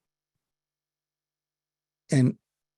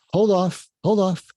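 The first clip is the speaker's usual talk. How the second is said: calm firm hold off; 5 semitones higher than usual. Shown as a demonstration. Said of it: "in a non-aggressive way".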